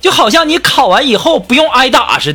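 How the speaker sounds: high-pitched indignant outburst; mock bellow. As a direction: imitate a nephew hollering back. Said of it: "就大吼说".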